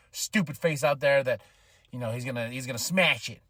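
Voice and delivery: ordinary talk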